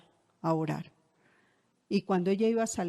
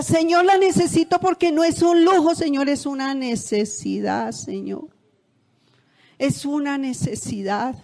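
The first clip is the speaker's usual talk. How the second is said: pleading prayer; 5 semitones higher, 5 dB louder than usual. Retold or imitated. Speaker retelling a prayer to the Lord, high insistent plea. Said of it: "mi oración era".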